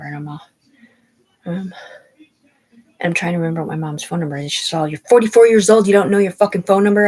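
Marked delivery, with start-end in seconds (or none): 4.9-7.1 loud, higher mock berating; mean scolding. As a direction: imitate a warden harshly berating a patient. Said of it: "being mean as fuck to me".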